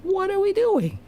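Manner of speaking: a lifted, wide-eyed high voice of sudden clarity; high incredulous realization. Retold or imitated. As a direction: voice leaps very high in amazed disbelief. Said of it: "you go like".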